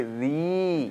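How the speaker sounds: री held long on its vowel; drawn-out mouth sound. Shown as a demonstration. Teacher leads students in the next letter group, long held री. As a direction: stretch री out slowly for repetition. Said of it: "एकदम पूरा मुंह से आ रहा है".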